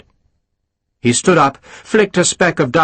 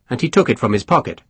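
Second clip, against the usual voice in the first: ordinary delivery, nothing marked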